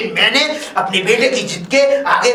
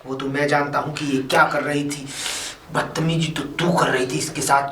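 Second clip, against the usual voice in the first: low, quiet reproach; stern rebuke; 6 semitones lower, 6 dB quieter than usual. Acted. Father-in-law blaming daughter-in-law, stern and quiet. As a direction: contain the anger, voice dropped.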